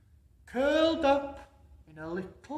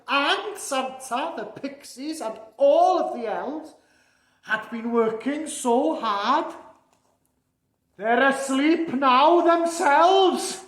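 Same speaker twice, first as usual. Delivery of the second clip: lively storybook reading building louder; storytelling voice rising to a big finish; story narration; 4 semitones higher, 10 dB louder than usual. A narrator's reading. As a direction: read the rhyme to the children with growing excitement. Santa reads rhyming storybook aloud.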